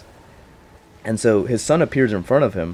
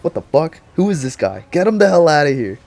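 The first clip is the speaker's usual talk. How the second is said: angry outburst; 7 semitones higher, 5 dB louder than usual. Retold or imitated. Pennywise confronted by Shining guy.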